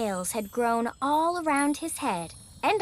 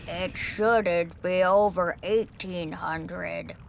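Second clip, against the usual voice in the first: low, slow, booming dragon; slow, deep voice of a proud dragon; pompous dragon voice; 4 semitones lower, slower than usual. Acted. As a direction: announce yourself like a grand old beast. Dragon explains his fever.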